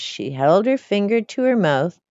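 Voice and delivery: ordinary delivery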